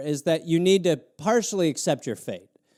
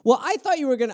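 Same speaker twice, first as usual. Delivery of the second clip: normal talking voice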